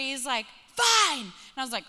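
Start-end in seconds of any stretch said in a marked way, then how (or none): 0.7-1.2 put-on high, loud huffy kid; short burst of mock tantrum; angry retort. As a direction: imitate a child's stroppy comeback. Angry son retorts to mom.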